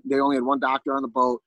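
ordinary talk

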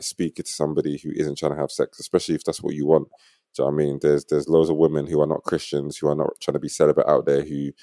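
usual speaking voice

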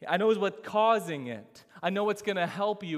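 a doctor announcing the diagnosis, loud and sure; confident doctor. Retold or imitated. Doctor reassures a long-suffering patient, loud and certain. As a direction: sound like the doctor who finally has the answer. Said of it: "He says".

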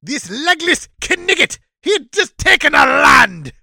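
high, loud French shout; french accent. Pretend. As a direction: yell like an indignant Frenchman. Voicing angry France.

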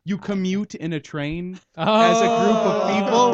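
high, loud punchline; excited. Real, not acted. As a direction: deliver the pun with glee. Delivering the pun punchline.

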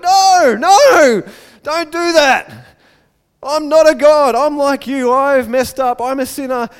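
high, loud pleading, easing off gradually; frantic acted refusal; alarmed protest. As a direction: shout them to a stop, then explain urgently. Paul frantically stopping worshippers.

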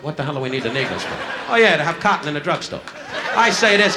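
ordinary talk, nothing marked

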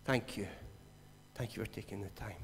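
lightly voiced grateful old man; quiet grateful old man. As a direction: thank him softly, almost whispering. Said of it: "he said".

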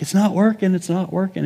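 little son whining to his dad that it won't budge; frustrated kid. Retold or imitated. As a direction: whine like a boy stuck on the bolts.

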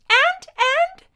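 put-on very high, loud, excited little boy; eager child. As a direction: bounce the words out like an impatient kid. Eager boy bouncing in seatbelt.

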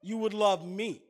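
loud, declaiming scripture reading; fervent sermon reading voice; preaching scripture. Acted. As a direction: proclaim the words of Jesus from the pulpit. Preacher reads Jesus' words aloud.

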